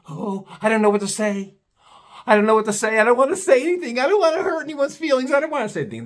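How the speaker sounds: high, fast mock-timid whine; mocking the frightened neighbors. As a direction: mimic scared little lambs, fretting. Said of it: "Little scared little lambs".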